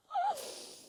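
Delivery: bursting laugh; high laugh. Real, not acted. Laugh after impression.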